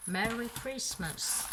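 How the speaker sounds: slow, drawn-out English accent, pitched on Merry; showy English accent. Shown as a demonstration. Crafter jokingly flaunting her accent on the ribbon's wording, showy English accent. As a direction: show off your superb English accent, stretching each word. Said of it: "mon superbe accent".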